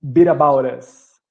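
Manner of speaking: "bit about us" run together as "biraboura"; linked tapped T. Shown as a demonstration. Teacher slowly linking "bit about us" for students. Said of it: "é muito comum ficar com esse som de R".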